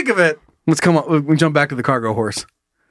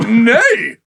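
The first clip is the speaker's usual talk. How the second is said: high neighed refusal; whinny the word like a horse; 4 semitones higher, 4 dB louder than usual. Acted. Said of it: "he's just like".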